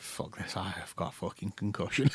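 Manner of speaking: muttered frustration. Retold or imitated. Frustrated inner voice, concussed.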